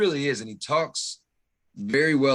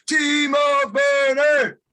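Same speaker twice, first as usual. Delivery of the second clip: high, loud, drawn-out chant; belted-out name; 13 semitones higher, 7 dB louder, much slower than usual. Pretend. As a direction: sing it out like a stadium crowd. Belts out nominee's name after hummed intro.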